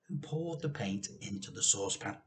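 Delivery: ordinary delivery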